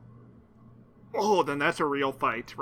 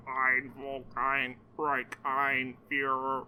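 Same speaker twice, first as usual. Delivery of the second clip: slow, softer robotic German chant; metallic cyborg voice; 3 semitones lower, 6 dB quieter, slower than usual. Shown as a demonstration. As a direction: drone it like a machine. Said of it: "in a robotic voice".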